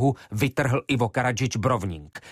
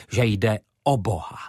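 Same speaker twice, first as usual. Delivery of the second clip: ordinary delivery, nothing marked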